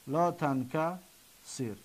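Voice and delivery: لا تنکسر slowly and softly, pausing before the final sir; slow, broken up. Shown as a demonstration. Teacher breaking down the prohibition, slow and broken up. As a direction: draw out لا تنکسر in separate pieces. Then repeats it slowly in pieces.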